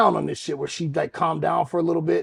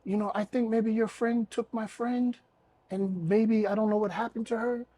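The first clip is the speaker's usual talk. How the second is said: higher, softer put-on voice; relaxed woman impression; 5 semitones higher, 4 dB quieter than usual. Woman explaining her worry to him once relaxed, high soft voice. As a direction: imitate her explaining herself, calmed down, higher and quieter. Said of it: "So she relaxed and she was".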